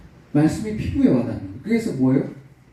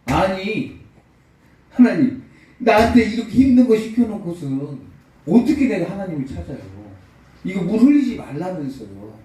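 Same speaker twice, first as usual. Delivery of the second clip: raised, higher voice in indignant complaint; angry protest; 6 semitones higher, 4 dB louder than usual. Retold or imitated. Abbot complaining angrily to God, raised voice. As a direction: protest hotly to God, pitch up. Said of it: "그 수도원장이 걸어 화가 나는 거예요".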